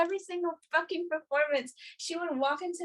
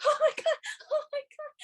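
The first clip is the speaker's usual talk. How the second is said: imitating a high, giddy squeal; mock-overwhelmed, laughing high voice; squealed excitement; 13 semitones higher than usual. Gwen reacting to Kim's name being called, imitated giddy squeal.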